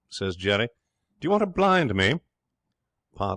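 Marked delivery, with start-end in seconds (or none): 1.2-2.2 much higher, louder exasperated question; irritated challenge. Acted. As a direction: snap it as a loud, high complaint. Jerry quarrels with Ralph.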